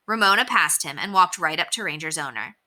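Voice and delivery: usual speaking voice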